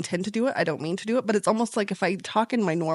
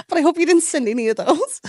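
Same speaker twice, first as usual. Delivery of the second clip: words through laughter; laughing; 4 semitones higher, 4 dB louder than usual. Real, not acted. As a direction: laugh while you say it. Laughing while speaking.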